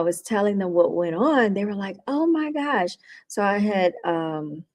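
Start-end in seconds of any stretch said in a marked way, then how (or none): 2.1-3.0 mildly surprised retold gasp of words; higher, imitating their surprise; mock-shocked reaction. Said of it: "so shocked and so surprised".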